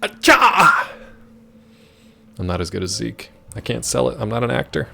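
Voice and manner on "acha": loud high sneeze; fake sneeze. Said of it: "You recorded your sneezes for TikTok?".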